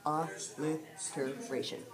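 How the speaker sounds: "Alliteration" slowly, syllable by syllable; broken into syllables. Shown as a demonstration. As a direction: sound out "Alliteration" in slow, separated parts. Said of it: "Big word".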